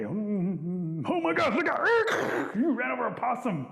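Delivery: humming along, then a high alarmed cry; startled driver. Acted. Storyteller as a driver who runs over a possum, startled.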